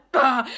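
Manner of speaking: loud choked sob burst; retold sob. Telling a stranger's story, a loud sudden choked crying sob. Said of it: "And I was like".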